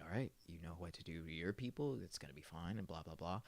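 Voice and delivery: a hint of a soft reassuring hero; lightly voiced hero. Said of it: "And he's like".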